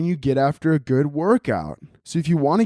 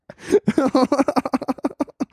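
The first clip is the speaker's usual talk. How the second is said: long high laugh; 11 semitones higher than usual. Real, not acted. Comic cracking up at his own joke with a long laugh. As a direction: crack up at your own bit.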